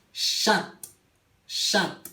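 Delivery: "Chat" opening on a long sh, closing on T; chat en criollo. Shown as a demonstration. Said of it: "la C y la H". ("Chat" con sh inicial y T final)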